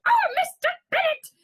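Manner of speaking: very high, loud, overwrought wail; Mrs Bennet. Acted. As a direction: shriek for your husband in melodrama. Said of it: "Missus Bennet".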